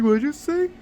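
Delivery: high, put-on tough-guy voice; mock-tough challenge. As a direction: imitate him squaring up, half-hearted. Gang member repeats challenge.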